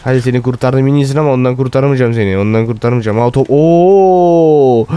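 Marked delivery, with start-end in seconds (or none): 3.2-4.9 drawn-out surprise. Real, not acted. Stumbling on a crowd in game.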